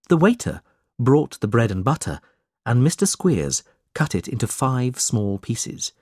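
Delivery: plain reading voice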